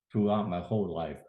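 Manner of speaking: ordinary delivery, nothing marked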